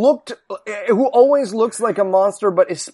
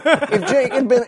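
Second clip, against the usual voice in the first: ordinary talk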